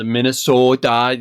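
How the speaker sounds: Minnesota with long northern O's; Iron Range accent. Shown as a demonstration. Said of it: "the Iron Range northern accent".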